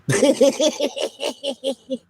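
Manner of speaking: long high laughing fit. Real, not acted. Laughing at the turtle punchline, long and high. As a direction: break up at your own punchline.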